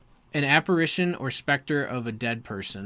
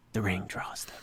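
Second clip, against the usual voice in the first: hushed, lower dramatic whisper; ominous whisper; 4 semitones lower, 5 dB quieter than usual. Acted. Dramatic reading of ominous line.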